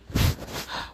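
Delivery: weary sigh. Acted. Naruto grumbles to Kakashi about another year, sighing. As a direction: let out an audible breath of a sigh.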